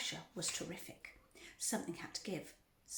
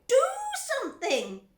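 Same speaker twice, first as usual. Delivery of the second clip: screaming; 12 dB louder than usual. A narrator's reading. Wife demanding her husband act, screaming. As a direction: yell it, stretching the first word. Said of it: "Missus Gloop screamed at Mister Gloop".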